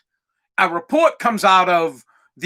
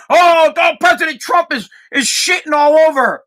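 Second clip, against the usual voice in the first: mock outrage; 6 semitones higher, 4 dB louder than usual. Mocking outraged Democrats.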